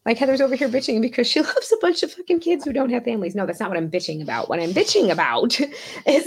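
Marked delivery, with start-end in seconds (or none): 0.0-3.3 a lightly voiced scornful onlooker, higher; half-hearted mock judgy voice; mock critic. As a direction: imitate someone sneering at her, pitched up. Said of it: "doesn't that sound terrible? Like".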